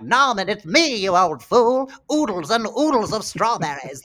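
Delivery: put-on high, loud quavery old lady voice. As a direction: do Katharine Hepburn as a spry old lady. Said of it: "how I learned to do an old lady voice".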